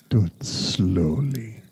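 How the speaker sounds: slow unvoiced whisper. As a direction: whisper it slowly. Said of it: "Do some more".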